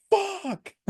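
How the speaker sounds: very high squealed curse; shocked squeal. Retold or imitated. Fan reacting to the headlock, high shocked squeal. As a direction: squeak the curse out in mock panic. Reenacting his shock at the attack.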